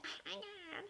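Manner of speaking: high, bubbly mock of an annoying manager. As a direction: mimic the chirpy manager, pitched high. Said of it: "she's all bubbly and freaking annoying".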